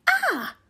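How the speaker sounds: sudden high startled yelp; short high yelp. A narrator's reading. Animal yelps as the house collapses, startled. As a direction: jump at the crash.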